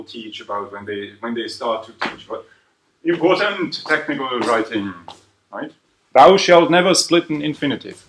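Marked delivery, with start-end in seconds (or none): 6.1-8.1 mock commandment. Shown as a demonstration. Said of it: "mock commandment".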